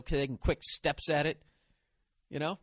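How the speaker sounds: usual speaking voice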